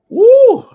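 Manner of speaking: a sudden high, loud joyful whoop; excited whoop. Real, not acted. Preacher whooping over the crowd, excited shout. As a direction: let out a whoop of pure excitement.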